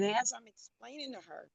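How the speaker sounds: faint voice far from the phone; fading away from the mic; barely audible. Real, not acted. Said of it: "have to bring this, the phone closer".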